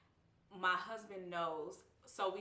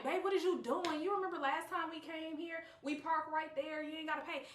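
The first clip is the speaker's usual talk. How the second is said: high-pitched, scolding the husband; high indignant scolding; 8 semitones higher than usual. Retold or imitated. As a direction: act indignant about the parking. Arguing with husband about parking.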